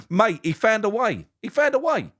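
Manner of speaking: high, gleeful exclaim; excited. Real, not acted. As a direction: crow it out in delight. Excited about the manager's win.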